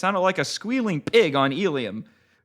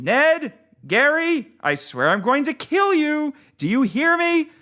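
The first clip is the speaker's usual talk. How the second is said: angry yelling; 10 semitones higher than usual. Acted. George yelling threats at friends.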